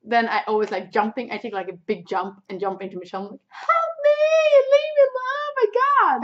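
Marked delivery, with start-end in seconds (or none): 3.5-6.2 very high, loud theatrical wailing; mock panicked scream. Jumping into her friend for rescue, mock panicked scream.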